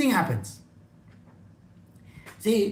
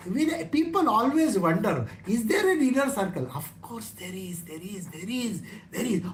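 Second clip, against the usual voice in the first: normal talking voice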